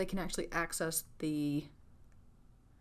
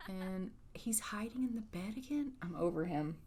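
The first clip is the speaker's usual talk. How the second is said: exasperated whispered aside; 4 dB quieter than usual. Real, not acted. Gamer whispering at her Sim hiding in bed. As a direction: hiss it under your breath in disbelief.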